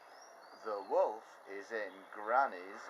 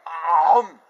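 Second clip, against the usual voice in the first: loud chomping gulp; gobbling noise; 7 semitones higher, 19 dB louder than usual. Acted. Wolf swallowing grandma, loud gulp.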